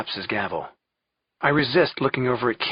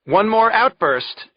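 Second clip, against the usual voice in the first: slow, loud, high-pitched warning; commanding judge's shout; 11 semitones higher, 8 dB louder, slower than usual. A narrator's reading. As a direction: shout for order over the courtroom. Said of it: "shouts Judge Rothstein".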